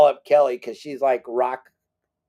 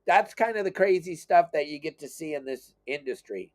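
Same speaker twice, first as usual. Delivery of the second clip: usual speaking voice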